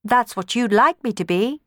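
usual speaking voice

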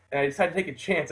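ordinary talk

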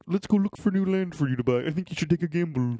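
put-on grandpa voice; affectionate old-man impression; Grandpa John. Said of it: "we can do that voice still".

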